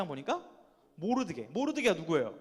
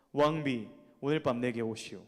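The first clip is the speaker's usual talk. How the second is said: a lower, courteous king's invitation; gentle king; 8 semitones lower than usual. Acted. King politely invites his queen, lower and gentle. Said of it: "이렇게 하면 좀 올만하죠, 목소리가?".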